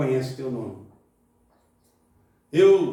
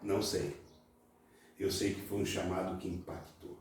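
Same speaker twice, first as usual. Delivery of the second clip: usual speaking voice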